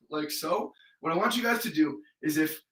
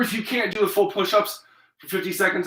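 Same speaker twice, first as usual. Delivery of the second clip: usual speaking voice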